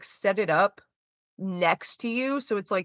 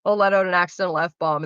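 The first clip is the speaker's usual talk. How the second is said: ordinary delivery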